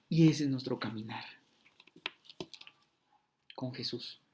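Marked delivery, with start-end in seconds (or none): none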